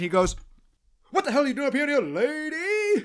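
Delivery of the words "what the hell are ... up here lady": high-pitched mock scream; screaming sergeant. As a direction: scream it like an outraged drill sergeant. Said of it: "screaming line by Sargent".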